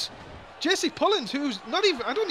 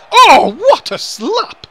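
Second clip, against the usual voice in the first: loud, high, slow exclaim; excited call; 6 semitones higher, 10 dB louder, much slower than usual. Real, not acted. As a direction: stretch it out in delight. Big slap lands in the match.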